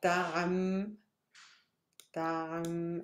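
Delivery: "ptam" held long, closing on the m; teacher isolating "ptam", drawn out on its own; syllable demo. Shown as a demonstration. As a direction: stretch "ptam" as one closed syllable. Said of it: "Pronounce like this".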